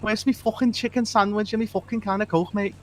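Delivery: attempted Scouse accent that comes out Welsh; mock Scouse accent. Friends tease him, so he tries a Scouse accent. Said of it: "You sound Welsh".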